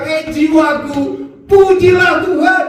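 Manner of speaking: high, drawn-out "pujilah Tuhan" that rises at the end; bright self-exhortation. Shown as a demonstration. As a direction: lift it up like a cheerful command. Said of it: "obatnya bilang".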